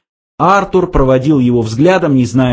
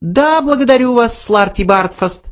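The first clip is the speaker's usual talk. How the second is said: high offhand mouse dismissal; careless; 11 semitones higher than usual. A narrator's reading. Benjy dismisses Slartibartfast, offhand high voice. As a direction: brush him off lightly. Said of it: "небрежно произнёс Бенджи".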